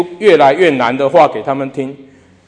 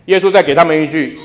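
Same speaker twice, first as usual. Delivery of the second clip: usual speaking voice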